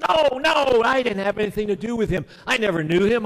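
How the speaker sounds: high, loud, fast panicked denial; frantic denial. Acted. Preacher acting Peter denying Jesus, high loud panicked denial. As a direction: deny it in a rush, voice jumping up. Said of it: "three times Peter said".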